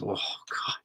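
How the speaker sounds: a hushed gasp of food bliss; awed whisper. Real, not acted. Raving about pastrami sandwiches.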